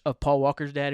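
usual speaking voice

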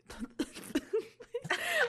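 high bursting laughter; big laugh. Real, not acted.

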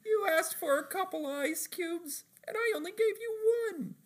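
high squeaky SpongeBob impression. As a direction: chirp it like a giggly cartoon sponge. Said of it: "And he's like".

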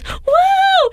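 high-pitched imitated "woo"; long, squealed mock whoop; imitated cheer. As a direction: mimic a woman whooping behind the speaker. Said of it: "a woman behind him goes".

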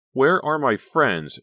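loud, high, drawn-out cry; anguished wail of a question. A narrator's reading. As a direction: call out in slow, high distress. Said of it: "she cried aloud".

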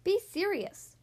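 high, sharp reprimand. A narrator's reading. Dentist to joking fox, sharp. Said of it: "said the dentist sharply".

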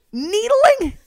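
high indignant disbelief; indignant echo. Real, not acted. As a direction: throw the word back, offended. Offended echo of a word.

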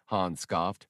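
normal talking voice

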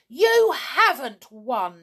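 slow, loud, high shout at Ronnie. A narrator's reading. Donald shouts at Ronnie that he hasn't won. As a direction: bellow it, drawn out and furious. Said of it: "he shouted to Ronnie".

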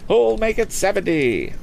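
high, brisk auctioneer selling a boat; auctioneer's pitch. Acted. Auctioneer coaxing bids from the lakeside crowd, lively and high.

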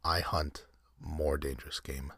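slow and hushed, menacing. Acted. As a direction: let each word land slowly. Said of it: "in the same slow tone".

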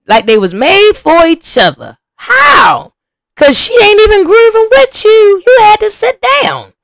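high, loud, indignant outburst; loud incredulous shouting at Twist on screen. Real, not acted. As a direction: yell it at the man on TV.

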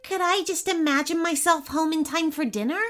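put-on high boy's voice, lifting at the end; hopeful. A narrator's reading. Boy asks the unicorn about getting home, hopeful. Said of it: "asked the boy hopefully".

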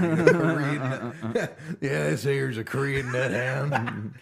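slow, laughing hillbilly drawl; hillbilly seller. Pretend. Friend jokes about a Korean nut hound, laughing hillbilly pitch. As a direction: hawk the dog like a country salesman.

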